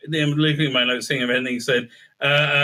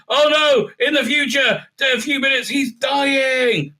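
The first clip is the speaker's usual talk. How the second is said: high, hammed-up melodramatic announcement; mock alarm; 8 semitones higher, slower than usual. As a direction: cry it out like a cheesy cliffhanger. Melodramatic retelling of a sudden flash-forward.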